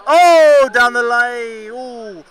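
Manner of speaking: loud, high burst of alarm at the ball; excited shout. Real, not acted. Commentator calling a wide to viewers, loud and high. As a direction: yelp it out as the ball flies past.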